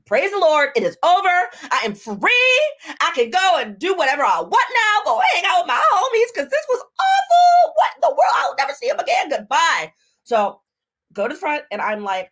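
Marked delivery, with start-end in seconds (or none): 0.0-9.9 high, loud mock celebration; exultant relief. Woman celebrating to herself after escaping an awful date, exultant. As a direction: play up wild relief, shouting at the peak.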